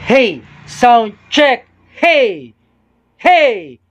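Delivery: full shouted sound check; shouting into the mic. Shown as a demonstration. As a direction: belt out hey to peak the meter. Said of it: "sumisigaw na talaga ako".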